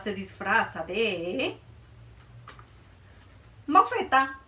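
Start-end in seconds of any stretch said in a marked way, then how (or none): none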